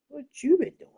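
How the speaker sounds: high, loud what you been doing; mock outrage. Asking the painted figure about its hands in a high, loud mock yell.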